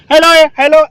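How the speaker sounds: hurried loud hello hello; wrong way demo. Shown as a demonstration. Trainer showing cadets the wrong radio habit, loud hurried hello. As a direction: show the flustered way not to do it. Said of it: "ऐसा नहीं करना है".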